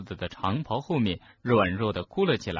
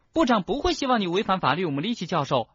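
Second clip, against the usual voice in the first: put-on high, fast, urgent girl's voice; loud shouted plea; 10 semitones higher, faster than usual. Schoolgirl appealing to the professor, shouting. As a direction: call out urgently to stop them. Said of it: "赫敏大声喊道".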